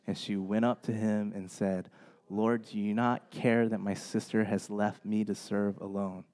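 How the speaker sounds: soft, even reading of a Bible passage; quiet communal Bible reading; scripture reading. Acted. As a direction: read the passage aloud with the congregation, low-key. Reading Luke passage aloud together.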